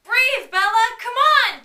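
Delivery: desperate pleading yell; begging shout. Acted. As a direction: beg loudly, frantic. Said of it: "Jacob begged".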